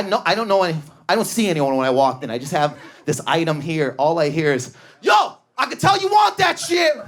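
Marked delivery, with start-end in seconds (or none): 5.0-6.9 high, hollered pitch at a customer; hammed-up high shout; mock sales yell. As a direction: imitate a bullying salesman yelling across the shop. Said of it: "their sales tactic is just to yell at you".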